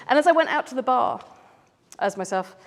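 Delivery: normal talking voice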